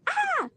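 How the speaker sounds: put-on sudden high-pitched yelp of a child; mock a boy's sensory outburst, short and sharp; imitated high yell. Mother imitates her son yelling out on a field trip, high yelp. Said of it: "he yelled out".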